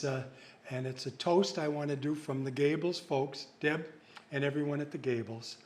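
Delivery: toasting friends and staff at the Gables, softly and low; heartfelt toast. Acted. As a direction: raise a glass and speak it gently.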